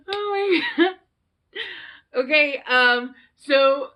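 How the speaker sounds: high and loud, still laughing; breaking up with laughter; laughing fit. Real, not acted. Vlogger cracking up at her own movie quote, laughing.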